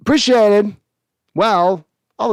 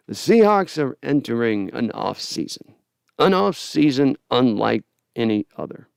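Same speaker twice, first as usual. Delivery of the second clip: Rod Serling impression. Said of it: "I'm working on my Rod Sterling".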